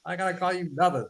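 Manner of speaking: high, scoffing put-on voice; indignant mock reply. Answering a pronoun demand, high and indignant. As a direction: play his own outraged comeback. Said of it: "that'd be my immediate response".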